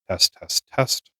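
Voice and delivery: mic with light noise reduction; lightly filtered test read; light noise cancellation. Shown as a demonstration. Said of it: "low noise reduce, uh, cancellation".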